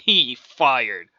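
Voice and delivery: plain reading voice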